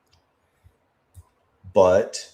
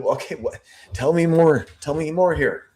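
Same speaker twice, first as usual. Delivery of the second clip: high, eager prodding for more; lightly acted investigator; 8 semitones higher than usual. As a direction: push the witness for details, lightly acted. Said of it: "he's going".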